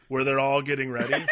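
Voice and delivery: usual speaking voice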